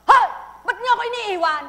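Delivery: high, loud, fast angry shout; demon's angry call. A narrator's reading. Demon yelling at the people leaving him, loud and fast. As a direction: yell at them as they leave. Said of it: "Sabi ng demonyo".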